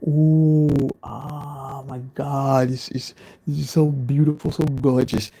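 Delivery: lightly voiced gushing fan, drawn out; a hint of a swooning groupie; mock swooning fan. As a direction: gush like a starstruck fan. Mocking Beast fans swooning.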